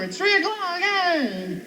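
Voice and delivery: high, whooping party cheer; mock celebration cry. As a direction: whoop as if midnight just struck.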